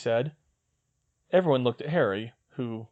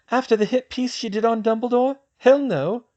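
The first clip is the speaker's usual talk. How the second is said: put-on higher young woman's voice, brisk; girl's voice; 12 semitones higher, 6 dB louder than usual. Hermione planning with Luna, in a higher girl's voice. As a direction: voice the girl, a clear step up in pitch. Female character voiced high.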